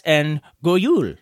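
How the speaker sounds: "God Jul" said slowly in a Danish way, low; Danish greeting. Shown as a demonstration. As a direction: pronounce "God Jul" like a Dane, slow and low. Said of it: "Merry Christmas in Danish".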